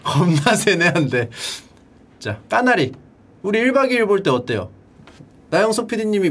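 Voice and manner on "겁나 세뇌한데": mock complaint. Mocking the repeated memorising drill.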